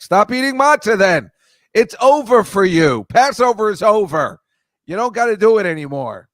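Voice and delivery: loud, high outburst at mother; retold fed-up shout, pitched well up; exasperated yelling. Daughter or son to mother over her matzah complaint, exasperated yelling.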